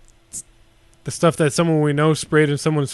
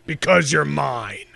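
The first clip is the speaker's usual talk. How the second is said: [name] voice; slower than usual. Pretend. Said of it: "Try [name] now".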